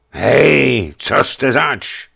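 loud, hoarse guard's call, slow on the question; sleepy guard calling loud and slow. Acted. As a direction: bark out a challenge at the gate. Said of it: "zvolal chraptivým hlasem strážný".

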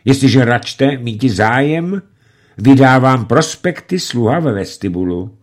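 smooth and officious; a hint of an unctuous bandit. A narrator's reading. Bandit clerk speaks politely. (úlisně zdvořile)

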